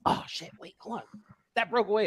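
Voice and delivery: usual speaking voice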